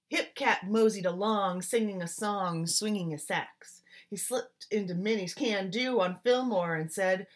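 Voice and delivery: put-on lively rhythmic read-aloud, like reading to children; story narration. A narrator's reading. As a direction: swing the rhymes like a jazz storyteller. Reads picture book aloud.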